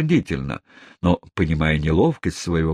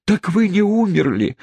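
hysterical exclaiming; 13 semitones higher than usual. Acted. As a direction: cry out in wild relief. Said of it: "восклицала истерическая леди". (истерическое восклицание облегчения)

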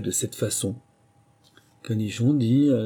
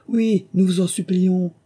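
high, loud, drawn-out entreaty; chorus of pleading; 11 semitones higher, 6 dB louder than usual. A narrator's reading. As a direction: plead slowly as if for a whole crowd. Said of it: "tous les autres dirent en chœur".

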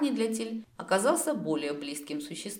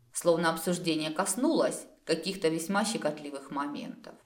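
ordinary delivery, nothing marked

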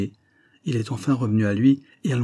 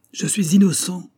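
high, louder cry of protest; desperate shout; 9 semitones higher, 4 dB louder than usual. A narrator's reading. Condemned man protesting innocence to the court, shouting. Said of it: "hurla le malheureux hors de lui".

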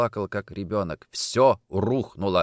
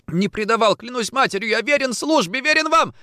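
high frightened protest, climbing louder; desperate oath; 13 semitones higher, 4 dB louder than usual. Acted. Orlov swearing loyalty at gunpoint, desperate oath. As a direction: plead for your life, pitch rising.

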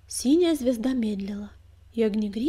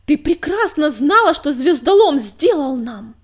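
loud, high enraged accusation; furious shout; 4 semitones higher, 13 dB louder than usual. A narrator's reading. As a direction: hurl the blame at her. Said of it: "захлебнулся от злобы".

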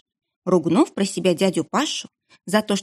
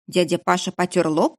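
ordinary delivery, nothing marked

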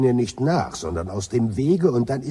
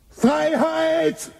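put-on much higher, loud drunken bellow; bellowing freedom; 6 dB louder than usual. Zafford drunkenly bellowing freedom through the ship. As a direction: roar the word out to the empty ship. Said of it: "Deshalb brüllst du nach Freiheit".

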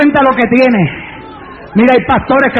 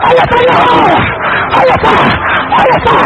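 shouted revival preaching; 6 semitones lower than usual. Acted. Preacher commanding healing and praise over the church, shouted revival preaching. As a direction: scream each praise in repeated bursts.

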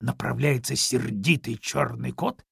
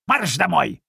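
raised-voice order from a mother dog; commanding; 4 semitones higher, 6 dB louder than usual. A narrator's reading. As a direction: order the puppy off sharply. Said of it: "скомандовала щенку собака". (командным тоном, повысив голос)

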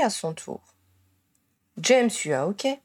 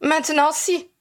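complaining; 7 semitones higher, 3 dB louder than usual. A narrator's reading. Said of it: "se plaignit Hermione".

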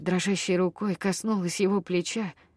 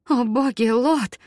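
higher, louder sudden gasp of alarm; alarmed cry; 5 semitones higher, 6 dB louder, slower than usual. A narrator's reading.

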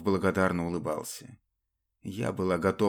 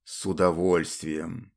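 low, slow, velvety purr; oily velvety voice; 6 semitones lower, slower than usual. Acted. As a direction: purr it, sickly smooth. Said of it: "противным бархатным голосом".

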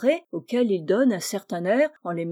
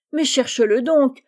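raised, impatient order; exclaiming; 5 semitones higher, 5 dB louder than usual. A narrator's reading. Brother urging Tony to search, impatient exclamation. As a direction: cry it out louder and higher. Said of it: "s'écria Georges".

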